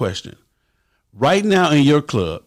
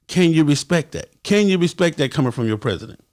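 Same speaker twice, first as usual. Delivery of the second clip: ordinary talk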